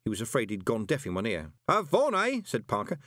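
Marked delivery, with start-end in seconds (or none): 1.7-2.4 high, loud, cheerful bellow. A narrator's reading. Parker cheerfully bellowing about his late spouses. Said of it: "bellowed cheerfully".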